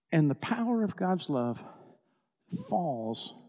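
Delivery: usual speaking voice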